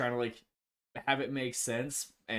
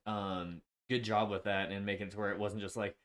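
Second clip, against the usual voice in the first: usual speaking voice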